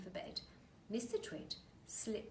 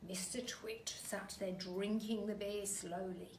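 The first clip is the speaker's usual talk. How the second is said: usual speaking voice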